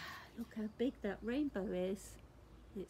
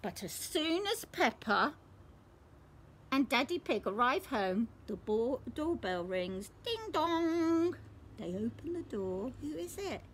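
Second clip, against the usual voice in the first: ordinary delivery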